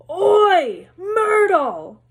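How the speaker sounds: big high bellowing call. A narrator's reading. Peeves bellowing to summon Myrtle, loud high shout. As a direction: bellow her name across the room.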